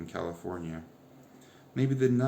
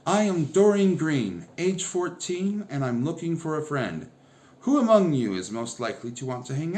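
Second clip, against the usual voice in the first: declaring to the room; 5 semitones higher, 7 dB louder than usual. A narrator's reading. Doreen declaring herself to the cafeteria, loud and high. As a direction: announce yourself to the whole cafeteria. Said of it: "leaping onto a table and declaring to the room".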